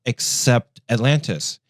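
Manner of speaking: usual speaking voice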